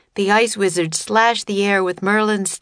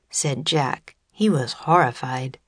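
usual speaking voice

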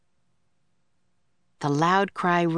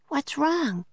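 high, anxious little question; a young girl's concerned ask; worried Annie; 9 semitones higher than usual. A narrator's reading. Annie to the stamping kangaroo, high and worried.

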